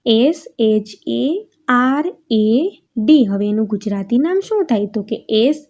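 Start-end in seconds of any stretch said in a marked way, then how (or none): 0.1-3.2 letters spelt out slowly one by one; slow letter-by-letter spelling. Shown as a demonstration. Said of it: "એમાં છે".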